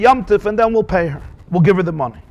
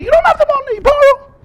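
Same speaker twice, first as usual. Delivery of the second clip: sing-song high. Acted. Rhetorical answer, sung high.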